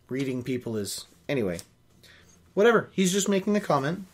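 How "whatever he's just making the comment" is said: ordinary delivery, nothing marked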